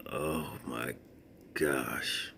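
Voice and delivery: soft whispered disbelief; hushed, resigned gasp; whispered oh my gosh. Real, not acted. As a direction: breathe it out quietly. Whispered aside.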